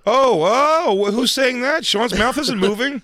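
high puppet voice; high squeaky ventriloquist voice. Pretend. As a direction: pitch it way up like a dummy.

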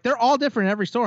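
fast, high mock cashier voice. Quoting the cashier's excuse, fast high mock voice. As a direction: rattle it off high like a know-it-all clerk.

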